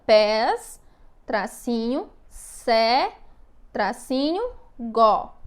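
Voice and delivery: "Pés", "sé", "gó" each set apart and stressed; syllable by syllable. Shown as a demonstration. Teacher spelling out the division, syllables isolated and stressed. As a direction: dictate each piece separately, pausing at every "tracinho". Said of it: "fica assim na separação silábica".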